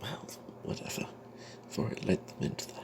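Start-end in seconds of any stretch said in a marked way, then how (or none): none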